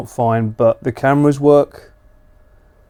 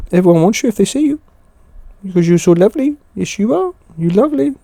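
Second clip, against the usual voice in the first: dog voice; 6 semitones higher than usual. Real, not acted. Streamer praising the dog he is sitting, high cooing baby talk. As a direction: coo at the dog, much higher. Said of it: "hearing [name]'s dog voice today".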